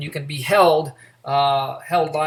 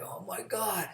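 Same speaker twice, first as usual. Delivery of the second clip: a muttered, much softer oh my God under the breath; exasperated groan; 3 semitones higher, 12 dB quieter, slower than usual. Retold or imitated. Officer fed up with the inmate calling again, exasperated mutter. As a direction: sigh it out like you've had enough.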